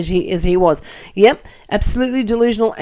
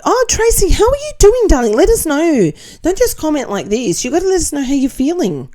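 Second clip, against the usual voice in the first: much higher, gushing hello to a viewer; excited greeting; 8 semitones higher than usual. Real, not acted. Cheerfully calling out to a viewer.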